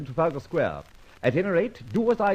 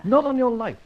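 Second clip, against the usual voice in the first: higher and louder, flat refusal; indignant outburst; 9 semitones higher, 5 dB louder than usual. Acted. Refusing his ward's advice, loud and indignant. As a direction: slam the idea down.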